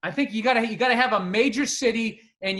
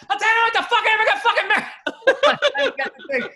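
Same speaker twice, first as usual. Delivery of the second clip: high, loud laughing rant; dad's outburst; 5 dB louder, much faster than usual. Retold or imitated. Dad ranting that he never got married, high loud laughing outburst. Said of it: "And then my dad".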